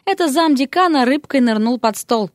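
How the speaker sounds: ordinary delivery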